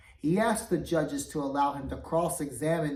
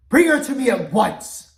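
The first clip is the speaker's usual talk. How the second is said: furious command; 8 semitones higher, 13 dB louder than usual. Acted. King furious, orders guards.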